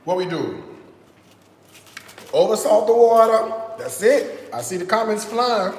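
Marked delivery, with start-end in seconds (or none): 0.0-3.5 hammed-up preacher; mock preaching. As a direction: call it out like a preacher. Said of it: "co-preach this sermon with me".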